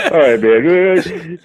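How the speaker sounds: real laughter through drawn-out words; loud, high sign-off breaking up with laughter; laughing. Real, not acted. Caller cracking up as he signs off, laughing.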